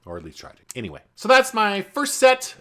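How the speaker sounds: ordinary talk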